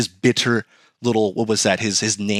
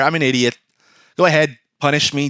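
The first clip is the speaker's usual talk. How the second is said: ordinary delivery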